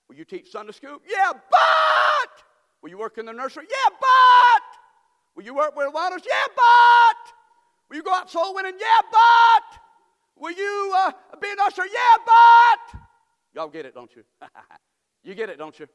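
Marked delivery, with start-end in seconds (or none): 1.1-13.0 shouted mock excuses.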